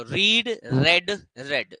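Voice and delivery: slow and careful, each form set apart; teacher demonstrating the forms; slow pronunciation demo. Shown as a demonstration. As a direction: spell out रीड then रेड slowly. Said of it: "इसकी forms लिखने में same है".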